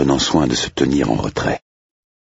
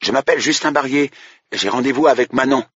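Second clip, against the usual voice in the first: put-on higher, polite young man at the door; a hopeful suitor announcing himself; pitched-up, slightly nervous young man; 12 semitones higher than usual. Justin on the intercom.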